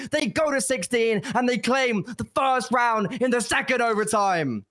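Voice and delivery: high, excited hype commentary; esports caster. Acted. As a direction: call the clutch at full energy. Overtime clutch play-by-play.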